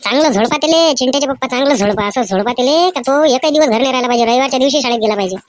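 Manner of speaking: mother complaining to her husband about the teacher's call. Acted. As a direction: tell the husband off about the boy. Mother urges husband to beat.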